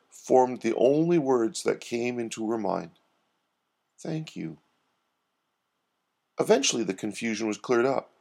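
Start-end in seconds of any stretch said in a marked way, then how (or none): 4.0-4.6 very soft, lower thanks; softened, moved. A narrator's reading. Doreen accepting Herbie as family, softly moved. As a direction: say it small and low, touched.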